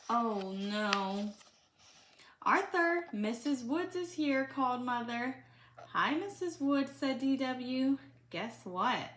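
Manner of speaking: put-on reading a picture book aloud to a child; lively read-aloud for a little one; storybook reading.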